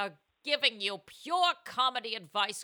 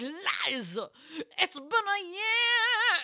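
mock weepy Joel; 9 semitones higher, 3 dB louder, much slower than usual. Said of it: "he's like".

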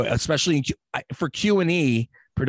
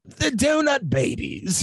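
slow, high-pitched mock English; deliberately bad English accent; 10 semitones higher, slower than usual. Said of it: "bad English accent on purpose".

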